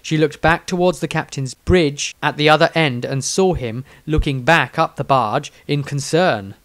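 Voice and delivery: usual speaking voice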